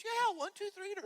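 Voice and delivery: wife snapping at her husband in a mock shriek. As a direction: shriek it back at him, fed up. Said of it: "She says".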